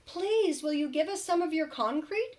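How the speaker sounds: high, pleading little wolf voices; little wolves. Acted. Wolf cubs ask the kangaroo for bricks, high and pleading. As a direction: ask sweetly like small cubs. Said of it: "asked the three little wolves".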